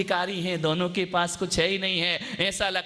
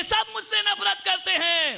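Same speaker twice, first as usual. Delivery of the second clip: put-on upset child; 13 semitones higher, 3 dB louder than usual. Crying child answers.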